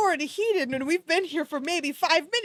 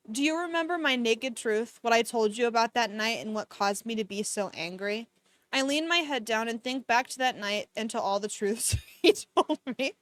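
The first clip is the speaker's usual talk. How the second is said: calm, even read-aloud of a romance novel; story narration; 4 semitones lower than usual. A narrator's reading. Streamer reading the novel to chat, even storytelling. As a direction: read the book steadily to the chat.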